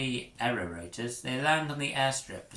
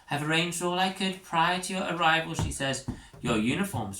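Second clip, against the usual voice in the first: prim teacher voice; 8 semitones higher, 5 dB louder than usual. Acted. Teacher Thurley speaks.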